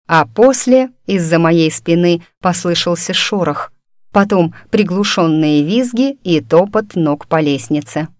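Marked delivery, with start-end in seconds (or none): none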